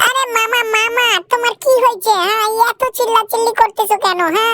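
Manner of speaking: put-on high-pitched kid sidekick, whiny; squeaky cartoon buddy voice. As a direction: play the little cartoon helper, high up. Cartoon sidekick talks to uncle.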